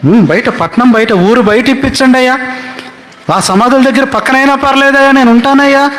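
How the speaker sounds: high, begging plea to the king; pleading David. Retold or imitated. David begging King Achish for land, high pleading voice. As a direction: beg humbly for a place near the graves, pitched high. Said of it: "ఎక్కడ మళ్ళీ ఎక్కడన్నాడు?".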